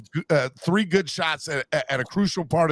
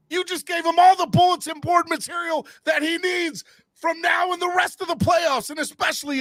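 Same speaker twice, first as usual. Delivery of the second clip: shout; 14 semitones higher than usual. Real, not acted. Fan yelling at Dylan Brooks about bulletin board material. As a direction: keep yelling at him.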